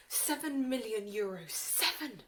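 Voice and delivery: loud, incredulous confrontation; outraged outburst. Acted. Confronting him with a figure.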